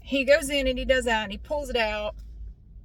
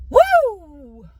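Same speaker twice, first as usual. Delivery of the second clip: loud, higher whoop; woo of pain; 7 semitones higher, 13 dB louder than usual. Retold or imitated. Teller reliving the nerve hit, loud whoop. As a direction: yelp it out like it hurt.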